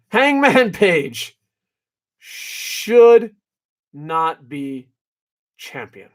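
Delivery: slow, deliberate words with long pauses, fading away; calm slow statement. Real, not acted. Reviewer calmly states an unpopular opinion, slow and deliberate. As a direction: spell it out slowly, word by word, letting the last word drop off. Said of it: "I'm gonna calmly state this".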